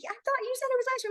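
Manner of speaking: put-on high, fast whiny kid voice; imitating a kid. Teacher imitates a kid complaining about ice cream, high and fast. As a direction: mimic the student's quick complaint. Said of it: "they came up to me like".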